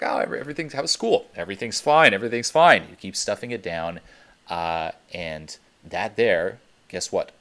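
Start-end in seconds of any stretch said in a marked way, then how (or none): 0.0-2.9 high, loud, falsely cheerful everything's fine; forced-cheery kid hiding the bullying; cheerful brush-off to parents. Retold or imitated. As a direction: cover it up brightly for mom and dad. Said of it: "you're like".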